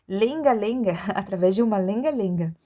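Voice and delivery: ordinary delivery, nothing marked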